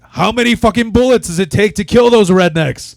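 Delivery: loud, high shouted punchline; shouting. Retold or imitated. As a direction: yell the joke out.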